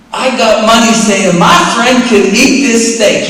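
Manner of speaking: loud, high-pitched wager; boastful betting call. Retold or imitated. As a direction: brag on your friend to the whole bar. Retold boast, betting before a crowd.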